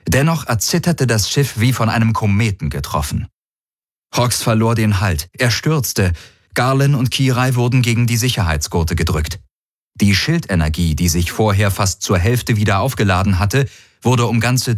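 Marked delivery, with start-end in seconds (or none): none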